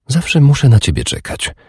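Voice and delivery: a playful little complaint; mock-complaining woman. A narrator's reading. Urdis complaining to her lover, high and pouting.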